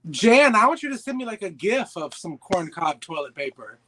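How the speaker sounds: ordinary talk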